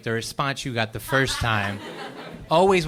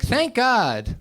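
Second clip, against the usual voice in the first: high, emphatic relief; relieved friend; 8 semitones higher than usual. Retold or imitated. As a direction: exclaim it with grateful relish. Friend's relieved reply, acted.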